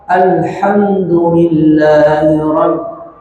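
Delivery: Alhamdulillahi rabb said slowly and evenly; correct one-beat mim. Shown as a demonstration. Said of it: "satu harokat". (mim satu harokat)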